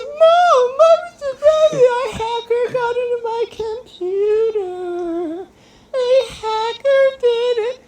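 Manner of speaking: very high, slow mock wail; hammed-up tearful squeal, words stretched out; panicked crying. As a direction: bawl it like a kid making excuses. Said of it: "it's complete panic".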